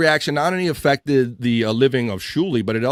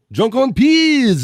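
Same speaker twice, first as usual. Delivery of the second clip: higher, louder, very slow sneer on the name; mock drawn-out name; 9 semitones higher, 5 dB louder, much slower than usual. Host mocking a rival's name, drawn out and high.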